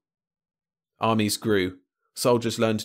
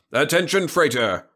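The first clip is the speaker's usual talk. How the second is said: raised, louder patrol officer's hail; blasting radio hail; 6 semitones higher, 5 dB louder than usual. A narrator's reading. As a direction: call out an order over the radio. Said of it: "the radio blasted".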